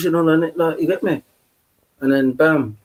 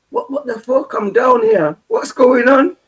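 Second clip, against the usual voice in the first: high, agitated retold outburst; reliving panicked disbelief; acted bewildered protest; 10 semitones higher than usual. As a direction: pitch it up, demanding an explanation.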